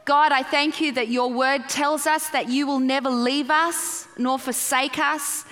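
ordinary talk

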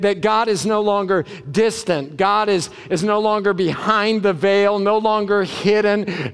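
ordinary talk, nothing marked

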